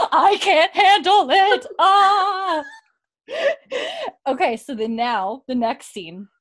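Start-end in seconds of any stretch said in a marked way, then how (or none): 0.1-2.6 loud, high, drawn-out imitation; overwhelmed mock squeal. Host imitates someone who can't cope, overwhelmed mock squeal. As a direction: wail it slowly at full pitch, hammed up. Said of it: "he's like".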